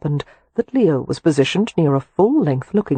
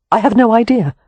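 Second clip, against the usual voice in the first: distressed; 6 semitones higher, 6 dB louder than usual. A narrator's reading. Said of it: "looking more distressed".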